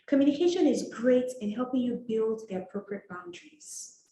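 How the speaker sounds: normal talking voice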